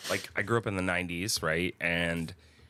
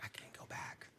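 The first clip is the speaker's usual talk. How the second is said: breathy whispered panic, no voice; hushed whisper of a guilty escape; 20 dB quieter than usual. Retold or imitated. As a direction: whisper it like sneaking out. Retelling fleeing a hookup.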